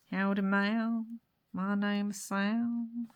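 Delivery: very slow mock-American drawl; poor American accent. Sam the scarecrow introduces himself, poor American accent. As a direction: stretch each word in a fake American accent. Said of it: "my really poor American accent".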